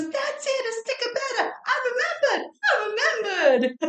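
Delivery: put-on very high, excited little girl with a New York accent. New York accent as a little girl cries out her triumph. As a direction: squeal it out like a thrilled New York kid. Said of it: "In this little New York accent".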